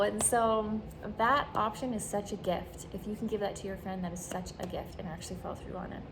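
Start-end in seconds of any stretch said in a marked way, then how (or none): none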